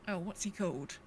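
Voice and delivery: normal talking voice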